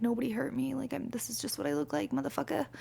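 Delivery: soft, muttered inner comeback; under her breath, annoyed; thinking it to herself, quietly; inner retort. Retold or imitated. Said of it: "I'm, like, in my head".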